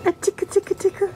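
high sing-song tickle chant; tickle-tickle up high and playful; giggly tickle attack. Acted. Tickling baby, high play voice.

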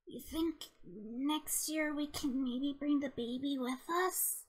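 soft, tentative question; hushed, hopeful request; gentle asking. Acted. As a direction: ask it quietly and carefully.

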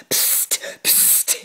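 sharp unvoiced spits; spitting psst. Lizard spitting out the coffee. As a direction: spit the sounds out in disgust. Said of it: "he spat".

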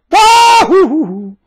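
high, slow, loud hooting "wah-hoo"; Pluto's whoop. Retold or imitated. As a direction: whoop like a planet on a joyride. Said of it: "I'm always going".

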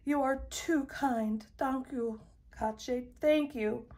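a touch of weepy gratitude; tearful thanks. A narrator's reading. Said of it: "sobbed Missus Deland".

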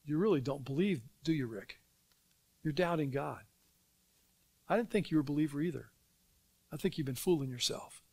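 lightly voiced devil sowing doubt under his breath; devil's whisper, imitated. Devil planting doubts in the preacher's ear, mock whisper. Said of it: "The devil whispers in my ear".

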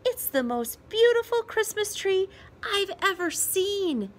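put-on awed little boy. A narrator's reading. Child Peter admiring the tree.

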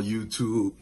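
ordinary talk

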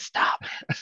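strained, breathy voice cracking; voice giving out. Pretend. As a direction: say it as if your voice quits. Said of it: "till my voice says".

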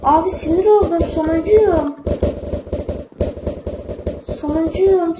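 fed-up gamer grumbling loudly; frustrated outburst. Real, not acted. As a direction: vent the anger at losing. Losing the game, fuming.

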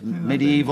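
"Medieval" said as middy-EE-val; corrective pronunciation. Shown as a demonstration. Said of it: "you want to pronounce it".

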